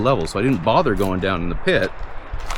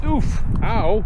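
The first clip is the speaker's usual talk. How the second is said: sudden yelps of pain; 10 semitones higher than usual. Real, not acted. Snagged by a bush.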